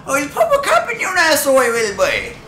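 high-pitched comic Irish character; singsong high voice falling at each phrase end; Irish Jesus character. Acted. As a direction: play a squeaky boastful Irish gunslinger. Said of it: "Now this is the Irish Jesus coming around".

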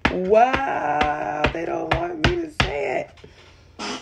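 high, slow words broken up by real laughter; laughing through words. Real, not acted. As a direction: giggle helplessly through the line. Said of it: "I'm just laughing 'cause I couldn't get the daggone words out".

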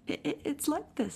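high, stammering, hurried little voice; quick. A narrator's reading. Piglet rescues Pooh's explanation, quick and nervous. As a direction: jump in quickly, tripping over the start. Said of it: "said Piglet quickly".

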